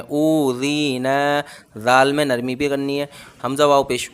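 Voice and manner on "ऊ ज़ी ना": ऊ ज़ी ना slow, each long vowel held equally; slow drawn-out recitation; all vowels stretched. Shown as a demonstration. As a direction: hold ऊ, ज़ी and ना the same length. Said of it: "तीनों ही बराबर खींचेंगे".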